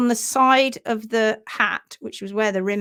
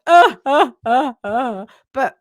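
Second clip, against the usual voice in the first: sobbing; 3 semitones higher, 9 dB louder than usual. Retold or imitated. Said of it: "weeping, not like".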